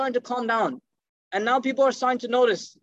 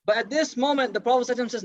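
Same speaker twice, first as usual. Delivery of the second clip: usual speaking voice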